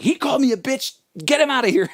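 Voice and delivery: a hint of outraged teacher; mock angry teacher. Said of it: "She's like".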